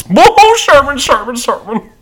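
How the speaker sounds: high, loud rapid-fire outburst; big high whoop, repeating the name; movie character impression. Imitating the Klumps movie family.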